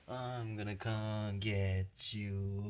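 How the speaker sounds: slow, drawn-out I'm gonna come get you, stretching get; slow teasing threat. Acted. Owner slowly teases the dog before chasing it. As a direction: drag it out to build suspense.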